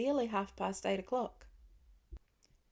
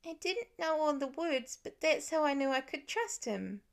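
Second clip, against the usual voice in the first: put-on higher child voice, steady and firm; earnest little boy; 6 semitones higher than usual. A narrator's reading. Nate explaining to his family, higher firm child voice. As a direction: close your case like a small kid.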